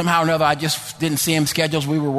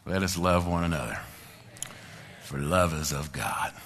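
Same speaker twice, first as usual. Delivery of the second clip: quiet exhortation; 13 semitones lower, 10 dB quieter than usual. Real, not acted. Closing exhortation, low and soft.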